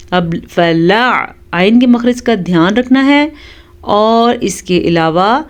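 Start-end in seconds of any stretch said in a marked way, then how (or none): 0.5-1.3 slow, drawn-out tajweed chant; Quran recitation. Shown as a demonstration. Teacher reciting for students. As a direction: intone the Arabic slowly.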